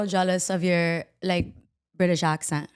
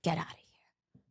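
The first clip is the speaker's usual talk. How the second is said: unvoiced hushed dismissal; whisper; 4 semitones lower, 12 dB quieter than usual. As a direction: hiss it away quietly.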